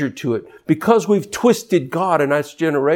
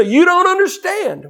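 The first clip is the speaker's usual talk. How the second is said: much higher, whiny protest voice; mock complaint; 13 semitones higher than usual. Preacher imitating people who resist, in a high whine. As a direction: mimic the complainer, pitched way up.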